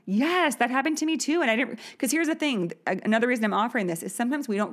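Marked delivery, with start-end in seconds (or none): 0.0-1.4 excited recognition. Retold or imitated. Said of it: "and be like".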